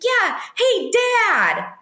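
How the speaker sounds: put-on a child calling across the room; little girl calling. Little girl calling her dad.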